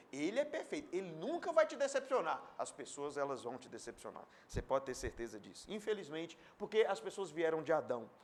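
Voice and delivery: usual speaking voice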